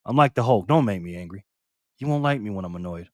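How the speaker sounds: low, restrained annoyance; annoyed. Real, not acted. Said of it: "I'm getting annoyed right now".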